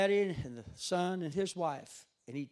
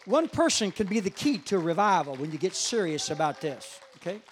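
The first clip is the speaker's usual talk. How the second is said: loud, raised preacher's voice; full-force preaching; 3 semitones higher, 9 dB louder than usual. Real, not acted. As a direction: proclaim it emphatically to the congregation. Drives the point home to congregation.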